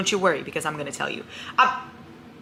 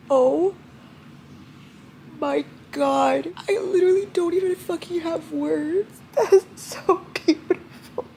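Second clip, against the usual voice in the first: high, tearful voice; moved to tears, pitch way up; crying with happiness; 10 semitones higher, slower than usual. Real, not acted. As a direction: squeak it out through tears. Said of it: "I'm either crying 'cause I'm sad".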